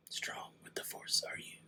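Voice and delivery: whispered Yoda riddle to a viewer. Acted. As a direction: hush it like a little Jedi master. Yoda-style line.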